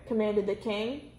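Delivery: ordinary delivery, nothing marked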